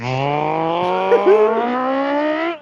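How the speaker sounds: rising engine roar made by voice; imitated engine revving. Imitates a one-stroke engine.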